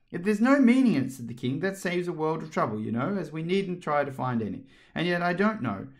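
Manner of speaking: lightly voiced King, lifted high. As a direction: pitch the King a little above the narration. Narrator plays the King.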